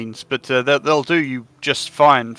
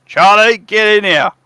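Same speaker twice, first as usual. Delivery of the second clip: loud, high yell out the door; shouting for Charlie; 9 semitones higher, 10 dB louder than usual. Acted. Shopkeeper yelling out the door for his helper. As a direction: bellow the name down the hall. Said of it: "shouts at the top of his lungs".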